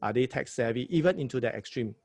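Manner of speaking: ordinary delivery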